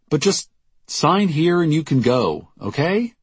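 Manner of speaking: high-pitched, exasperated giving in; reluctant shopkeeper Jackie. Acted. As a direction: lay out the terms in a high, insistent voice. Jackie gives in to visitor.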